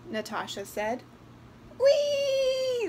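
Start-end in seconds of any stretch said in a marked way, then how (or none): 1.8-2.9 high, loud gleeful "whee"; doll's squeal. A narrator's reading. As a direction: squeal like a doll on a swing. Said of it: "the doll squealed".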